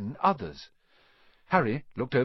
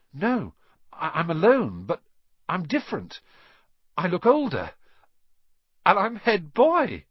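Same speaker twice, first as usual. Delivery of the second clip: high, rising astonishment; thrilled at what he sees; amazed; 6 semitones higher, 5 dB louder than usual. A narrator's reading. Ron sees himself older.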